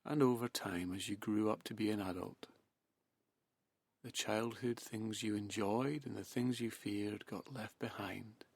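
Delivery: hushed guided hypnosis, unhurried and spaced out; hypnotic trance induction. Acted.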